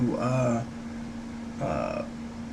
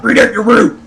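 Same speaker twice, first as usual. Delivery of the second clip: loud put-on scolding voice; hammed-up loud telling-off; mock reprimand; 5 semitones higher, 9 dB louder than usual. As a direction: imitate someone telling you off, over and over. I get reprimanded all the time.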